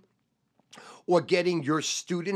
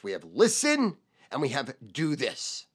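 ordinary talk, nothing marked

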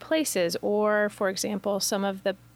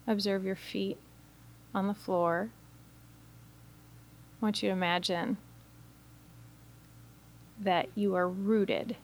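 guided meditation; 4 dB quieter than usual. Acted. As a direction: guide listeners gently into stillness, phrase by phrase. Leads a guided imagery meditation.

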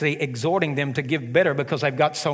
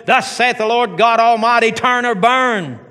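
thundering prophet; 7 semitones higher, 8 dB louder than usual. Pretend. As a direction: boom it out like a prophet of doom. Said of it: "like this thundering prophet".

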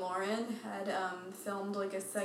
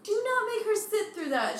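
indignant; 12 semitones higher, 4 dB louder than usual. Real, not acted. Indignant about child at shows.

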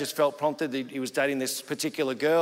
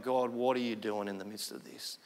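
softer, lower questioning of God; subdued prayer to God; 7 semitones lower, 9 dB quieter than usual. Retold or imitated. Man driving home questions God, subdued. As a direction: quietly ask God, voice dropped. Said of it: "he's just driving home going".

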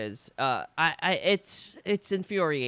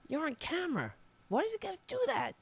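high-pitched, softer scolding; exasperated scolding; 11 semitones higher, 6 dB quieter, faster than usual. Real, not acted. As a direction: scold a pet from off the mic. Scolding Francis off the mic.

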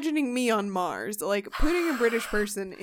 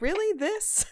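incredulous; 9 semitones higher than usual. Retold or imitated. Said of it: "I'm like".

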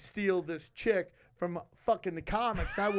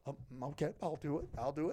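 much softer, meek eager agreement; sheepish volunteer; 4 semitones lower, 10 dB quieter, faster than usual. Retold or imitated. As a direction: shyly offer yourself up. Ari volunteering timidly.

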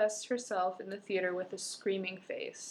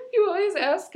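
ordinary delivery

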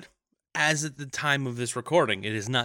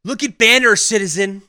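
loud, barking propaganda order; mock dictator shout; 10 semitones higher, 12 dB louder than usual. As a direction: bellow it like a state official.

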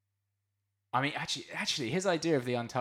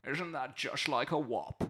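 film gangster quote; 3 semitones higher, slower than usual. Retold or imitated. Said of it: "and he's like".

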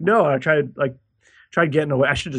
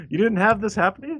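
laughing; 8 semitones higher than usual. Real, not acted. Laughing at friend's shock.

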